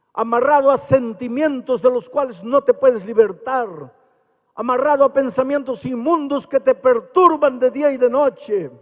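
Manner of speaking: high, loud sermon voice at full force; fiery preaching. Acted.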